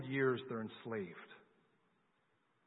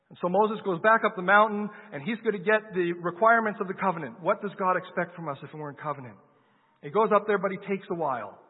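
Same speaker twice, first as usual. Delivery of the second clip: louder, higher preaching build; preaching at full force; 3 semitones higher, 5 dB louder than usual. Acted. Preacher telling the Exodus to the congregation, full-force preaching. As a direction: drive the story home to the congregation.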